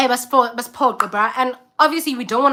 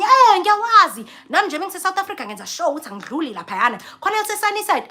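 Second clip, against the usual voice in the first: a hint of a chatty host, higher; host's chatty voice; 8 semitones higher, 3 dB louder, faster than usual. Host chatting with the guest, higher pitched. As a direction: chat back eagerly. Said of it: "Athi omunye".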